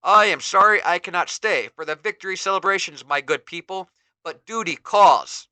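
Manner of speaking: noble farewell. Acted. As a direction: take leave nobly. Hero addresses villagers.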